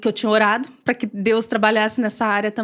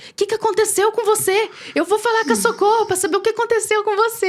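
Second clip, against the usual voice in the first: much higher, alarmed questioning; quoting her worried husband, voice pitched up; anxious husband; 9 semitones higher than usual. Retold or imitated.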